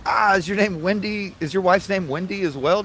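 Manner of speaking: high, loud, slow eager question; eager surprised question. Retold or imitated. Tourist in the sauna asks the stranger eagerly, high and loud. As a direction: lean in, pitch up, all curious.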